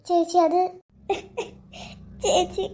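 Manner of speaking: halting, tearful and subdued; crying. Acted. Upset girl starting to confide in her chechi, crying. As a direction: struggle to get it out through tears. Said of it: "നീ ഇങ്ങനെ കരയല്ലേ".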